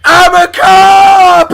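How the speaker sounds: high, long drawn-out shout; crazed mock proclamation; hammed-up cop yell. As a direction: bellow it slowly, stretching each word.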